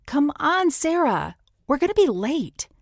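high, loud call across the room. A narrator's reading. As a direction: call her to hurry up. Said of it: "Amy called".

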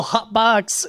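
mock yell of a sports call; retold yell. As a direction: call it out high, half-yelling. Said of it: "They have to yell".

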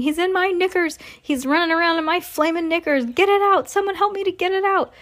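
high-pitched comic panic; panicked screaming. A narrator's reading. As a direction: beg for rescue in a high voice. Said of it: "the man was screaming".

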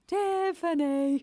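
high, drawn-out plaintive name call; mock pleading reality-show suitor; Sam from Made in Chelsea. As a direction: stretch the name out, hammed-up. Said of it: "Sam, is trying to win back Tiffany".

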